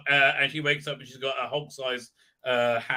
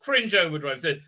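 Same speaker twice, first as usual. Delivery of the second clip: normal talking voice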